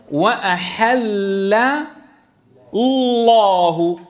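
very slow "وأحل الله", thin lam in "وأحل", pause, thick lam in "الله"; drawn-out "وأحل الله" contrasting a thin first lam with a full second; light lam, heavy lam. Shown as a demonstration. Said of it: "ليش عم نفخم الثاني؟".

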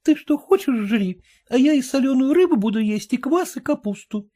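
high, curt wife; cutting retort. Acted. Wife cutting off husband, high curt voice. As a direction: snap back as the wife. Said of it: "отрезала жена".

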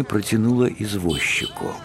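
plain reading voice

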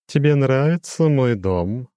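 high, slow, chiming demon voice; lofty demon with a glassy ring; crystal ringing voice. A narrator's reading. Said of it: "прозвенел он". (высокий звенящий хрустальный голос демона)

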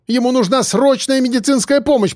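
high, cracking desperate plea; отчаянная мольба; despairing plea. A narrator's reading. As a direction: beg as the voice breaks. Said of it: "в нём звучало отчаяние".

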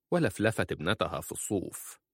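ordinary delivery, nothing marked

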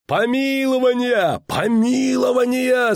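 high, slow roaring shout; crowd roaring. Acted. Crowd roaring for the pardon, high drawn-out shout. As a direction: chant it like an angry mob. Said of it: "зарычала толпа".

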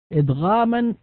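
normal talking voice

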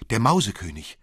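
a loud, high frightened cry. Acted. Marie, woken at midnight, cries out in terror.

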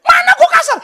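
high, shouted mock protest; hammed-up yelling in self-defense; loud voice. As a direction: bellow your innocence. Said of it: "Maksudnya suara om keras".

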